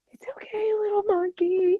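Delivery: very high, strained mock-tearful voice; acted weepy reassurance; imitated tearful self-soothing. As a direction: squeak it out as if about to cry. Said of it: "I just need to go cry".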